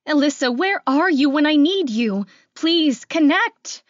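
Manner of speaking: loud, high cry of desperation; desperate plea. Acted. Caller begging a friend's phone to connect, desperate. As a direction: beg urgently, stretching the last words. Desperate call to someone unreachable.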